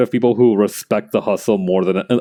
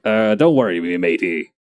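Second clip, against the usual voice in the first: nervous pirate captain; 4 semitones lower than usual. Retold or imitated. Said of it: "nervously starting".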